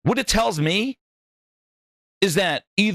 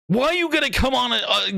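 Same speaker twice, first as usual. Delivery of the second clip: normal talking voice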